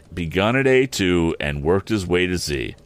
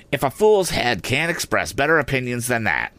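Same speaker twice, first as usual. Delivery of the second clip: higher, lightly voiced scolding; scolding sister; 5 semitones higher than usual. A narrator's reading. Sister dismissing Joe's suggestion, scolding. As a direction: scold a fool for his opinion.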